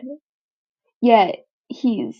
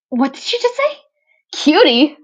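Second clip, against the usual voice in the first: flustered disbelief; 6 semitones higher, 7 dB louder than usual. Acted. Izuku, blushing, echoes Toga's cutie in shock. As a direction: voice jumps up high and loud in shock.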